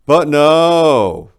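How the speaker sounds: loud, high But no!; a sudden loud complaint; exasperated outburst. Acted. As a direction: throw up your hands and yell it. Exasperated rant at the bird.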